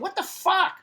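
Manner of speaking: high-pitched, incredulous challenge; indignant disbelief. Retold or imitated. Bystander challenging a cop siding with police, indignant.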